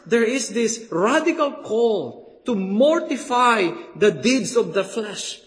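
ordinary talk

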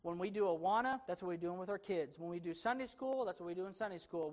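ordinary delivery

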